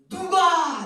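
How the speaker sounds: high, loud desperate question; desperate cry. Acted. Paul crying out for rescue, loud and desperate. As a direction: cry out the single word in anguish. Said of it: "이렇게 말해요".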